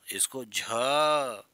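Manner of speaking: झ slowly drawn out with much breath; breathy झ demo. Shown as a demonstration. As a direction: stretch झ and let the air flow. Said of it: "ज़्यादा हवा निकालनी है".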